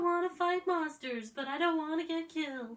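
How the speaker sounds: loud, high-pitched whine; mock whining. Impersonating Dawn in the finale, high whining. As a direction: whine it like a bratty kid. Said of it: "Dawn was whining in the finale".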